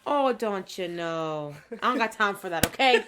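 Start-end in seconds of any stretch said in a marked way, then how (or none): 0.1-1.6 slow, stretched Minnesotan accent; hammed-up Minnesotan. Shown as a demonstration. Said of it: "I can get real Minnesotan".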